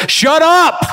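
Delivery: high furious yell of a fed-up dad; angry shout. Retold or imitated. Angry father yelling at his misbehaving child in church. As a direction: snap it out like an enraged father.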